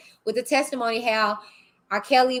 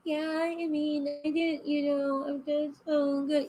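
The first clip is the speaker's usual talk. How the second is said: somber imitation; 7 dB quieter than usual. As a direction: imitate her hushed, somber tone. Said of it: "She's all somber".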